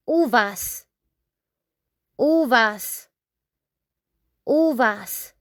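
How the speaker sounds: "uvas" with a pure "oo": OO-vas; Spanish word drill. Shown as a demonstration. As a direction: model "uvas" slowly and clearly. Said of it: "we pronounce in Spanish".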